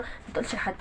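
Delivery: unvoiced whisper, hushed and low; secretive whisper. Mother hushing son not to tell anyone, whispered.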